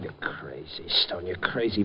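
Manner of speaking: stunned whisper. Acted. Harry hears Peggy was switched, stunned whisper. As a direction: breathe it out in shock.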